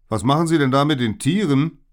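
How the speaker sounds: high, loud challenge from afar; gerufen. A narrator's reading. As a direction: call out indignantly to strangers. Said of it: "hörte ich einen Weichschnabel rufen". (laut und hoch gerufen)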